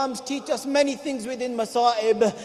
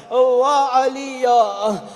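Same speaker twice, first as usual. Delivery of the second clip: mournful lament; 8 dB louder, slower than usual. Real, not acted. Preacher laments.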